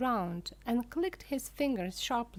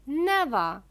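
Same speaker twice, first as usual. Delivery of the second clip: loud, higher cry; cried out; 6 semitones higher, 10 dB louder than usual. A narrator's reading. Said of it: "cried Mister Wonka".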